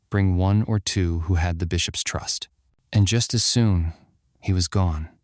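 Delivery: usual speaking voice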